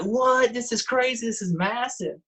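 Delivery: high-pitched, amazed exclamation; retold amazement. Diver telling host of his first shark tooth find, amazed. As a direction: react wide-eyed to a huge find.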